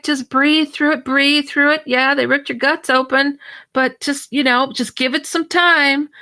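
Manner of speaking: lightly mocking counselor voice; mock soothing counselor. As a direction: parody their soothing bedside talk.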